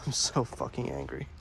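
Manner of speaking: playful low growl of fake fury; mock deep angry voice. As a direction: ham up the anger in a low, gruff put-on voice. Repeated in a mock voice.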